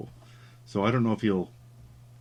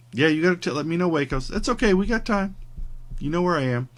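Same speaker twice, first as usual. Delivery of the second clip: higher, louder imitation of a bubbly friend; chatty friend; 9 semitones higher, 6 dB louder, faster than usual. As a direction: voice the friend's cheerful reply, pitched up. Quoting the friend's chatty exchange.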